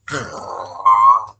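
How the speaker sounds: big loud swooning sigh; dreamy mock sigh. Reader mocks the nerd swooning over Mom, loud sigh. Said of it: "I know that's how he says it".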